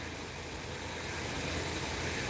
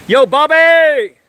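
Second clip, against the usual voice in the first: long, high, loud call; 14 semitones higher, 9 dB louder, much slower than usual. Real, not acted. Calling the polar bear out of his cave, slow loud holler. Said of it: "I'm gonna yell to him".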